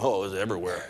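low, soft, laughing through the words; laughing buddy. Retold or imitated. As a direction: chuckle it out as the hungover friend. Friend recalls drunken night.

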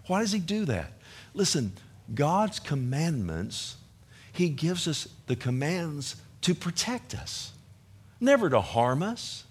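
ordinary delivery

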